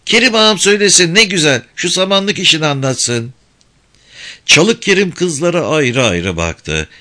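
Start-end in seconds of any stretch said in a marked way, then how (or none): 0.1-3.3 high, loud, fast yelling; shouting girls. Acted. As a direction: yell over each other. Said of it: "hep birden bağırmaya başladılar". (hep bir ağızdan bağırarak)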